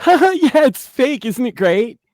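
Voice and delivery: high, loud sarcastic mimicry of a smug fan; mock the fans who brag it's fake; sneering impression of a gleeful fan; mocking fan quote. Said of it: "am sick and tired of the".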